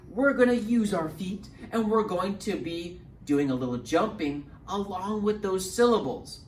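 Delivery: usual speaking voice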